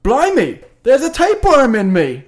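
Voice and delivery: high-pitched, hammed-up British exclamation; mock British shout. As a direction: cry out like a startled cartoon Brit. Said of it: "he just shouts".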